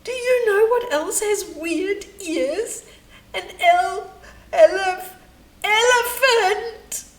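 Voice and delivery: high, loud, sobbing; weeping. A narrator's reading. Fragility retorting to Rudy while still weeping. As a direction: push through the sobs. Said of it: "Weeps and all".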